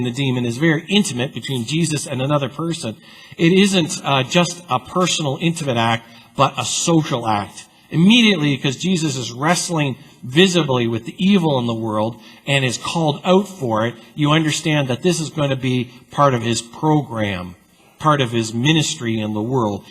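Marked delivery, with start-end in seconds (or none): none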